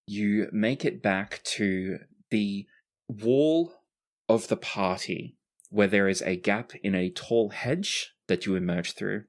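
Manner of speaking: ordinary talk, nothing marked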